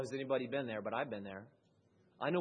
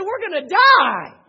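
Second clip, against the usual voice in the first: high, loud, terrified wail; a loud high mock terror; panicked cry; 19 dB louder, slower than usual. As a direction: cry out that death is near. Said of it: "They said".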